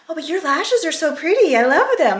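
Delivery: put-on high gushing teen voice; bubbly teenage self; teen girl voice. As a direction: gush like a teenager. Teenage self in dream replies.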